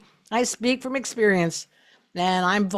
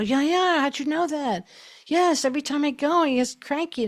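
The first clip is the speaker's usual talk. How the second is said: a much higher, eager voice for the amazed parent; surprised parent; 8 semitones higher than usual. Retold or imitated. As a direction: play the parent, wide-eyed and eager. Parent answering about their kid.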